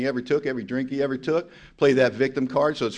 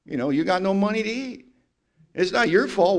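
usual speaking voice

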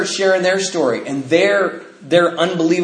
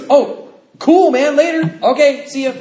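higher, louder, chirpy mock of an upbeat friend; excited caller; 10 semitones higher, 5 dB louder than usual. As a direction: toss off a breezy cheerful goodbye on the phone. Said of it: "He goes".

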